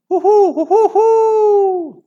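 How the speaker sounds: slow, high, loud hooting owl cry; eerie hooting owl. Acted. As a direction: hoot like a tawny owl in the night. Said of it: "an eerie wavering call".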